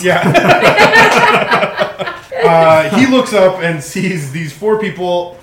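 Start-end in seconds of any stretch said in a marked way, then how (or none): 0.3-2.4 high, loud real laugh; burst of laughter. Real, not acted. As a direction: crack up out loud. Table cracks up at greeting.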